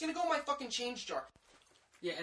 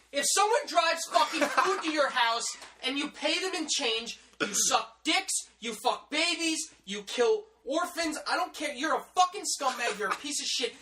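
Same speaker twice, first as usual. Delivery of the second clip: loud, higher-pitched comic tirade; angry rant; 5 semitones higher, 4 dB louder than usual. Pretend. Delivery driver berating customers who pay in change, angry rant. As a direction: hurl each insult louder and higher.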